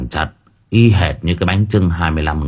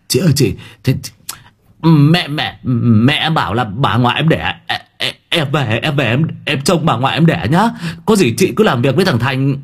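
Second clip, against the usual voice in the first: higher, stammering hurried excuse; 5 semitones higher than usual. Acted. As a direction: babble excuses and back away. Hải making excuse to flee.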